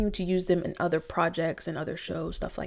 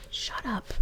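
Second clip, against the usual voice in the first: whispered shut up; 3 semitones higher, 10 dB quieter than usual. Retold or imitated. Imitating mama hushing a child.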